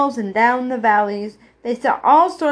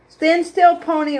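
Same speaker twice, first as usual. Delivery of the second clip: raised, loud opening command; ordering; 6 semitones higher, 3 dB louder than usual. A narrator's reading. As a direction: give a high, firm order. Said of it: "ordered Edith".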